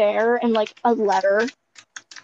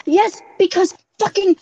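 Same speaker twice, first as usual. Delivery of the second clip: gasping yes; 8 semitones higher, 3 dB louder than usual. Real, not acted. Gasp then yes.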